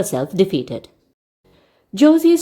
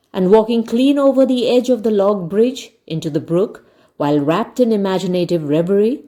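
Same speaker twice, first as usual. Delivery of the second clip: plain reading voice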